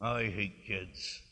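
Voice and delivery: low, slow mock grumble; kidding grumble. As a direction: grumble it softly and slowly, only teasing. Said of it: "I do say it kiddingly".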